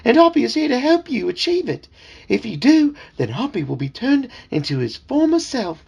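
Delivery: high, fussy talking frog calling him sir; chirpy, insistent little frog servant; eager frog voice. Acted. Dad voicing the talking frog for his kids, high and eager.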